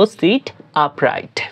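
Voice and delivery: slow, deliberate phrase; teacher pronouncing slowly; slow demo. Shown as a demonstration. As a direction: draw out each word for learners. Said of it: "বলার ক্ষেত্রে বলবো".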